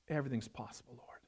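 hushed whispered prayer. Real, not acted. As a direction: whisper it in awe.

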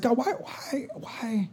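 soft, hushed "why" to God; pleading with God. Retold or imitated. Pastor retelling his hushed plea to God. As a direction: ask God quietly, almost under breath. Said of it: "I was like".

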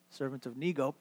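SERVANT OF NEGO low and very soft; hushed, private backtalk; muttered under the breath. Acted. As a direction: sneak out the altered name in a quiet mumble.

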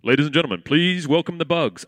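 low, loud announcer boom. Retold or imitated. Theater announcer returns.

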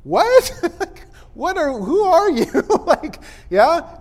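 high, loud "what" breaking into baffled questions; incredulous shock. Acted. Followers hearing they must eat his flesh, incredulous shock. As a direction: balk in amused disbelief. Acting out the listeners' shocked reaction.

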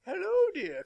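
put-on high, loud old-lady falsetto greeting; sudden high Doubtfire trill; Mrs Doubtfire voice. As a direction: sing out like the nanny at the door. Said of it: "Missus Doubtfire is fucking awesome".

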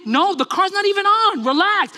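high, worked-up retort; hammed-up exasperation, voice shooting up; exasperated yell. Driver answering mother in parked car, exasperated yell.

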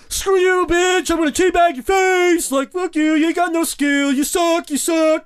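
hammed-up trash talk shouted way up high; mock gamer rage. Imitated Call of Duty trash talk.

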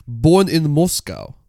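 emphatic mock Russian accent; comic Russian, deliberate and pointed. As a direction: insist in a put-on Russian accent. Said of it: "the accent that the Brenton should use".

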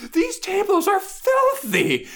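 very high, wailing about her tables; distraught sketch woman. Retold or imitated. Retelling the sketch, very high distraught wail. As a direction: wail over ruined tables. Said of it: "she's like".

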